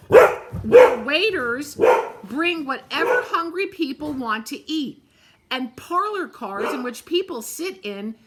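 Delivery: loud, haughty passenger engine; snorting scorn. A narrator's reading. Shiny new engine snorts at the toys, haughty and loud. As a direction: sneer down at the little train.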